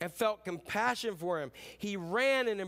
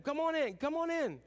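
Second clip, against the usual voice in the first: higher, coaxing invitation; pleading father; 8 semitones higher than usual. Retold or imitated. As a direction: gently coax him to come inside. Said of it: "began pleading with him".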